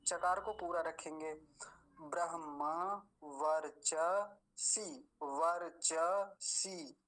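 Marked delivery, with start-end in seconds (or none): 2.0-7.0 drawn out syllable by syllable, ब्रह्मवर्चसी; slow recitation demo. Shown as a demonstration. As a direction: stretch ब्रह्मवर्चसी slowly for pupils. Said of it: "लंबा खींचेंगे थोड़ा सा".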